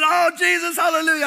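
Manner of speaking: high, loud ecstatic praise; ecstatic cry. Retold or imitated. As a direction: cry out in rapture like the girl. Girl filled with the Spirit.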